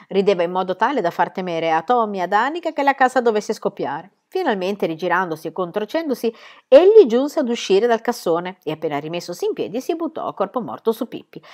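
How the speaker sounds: ordinary delivery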